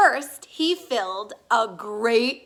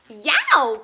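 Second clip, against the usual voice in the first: louder yelp; cried out; 8 dB louder than usual. A narrator's reading. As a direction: yelp from hot porridge. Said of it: "cried Baby Bear".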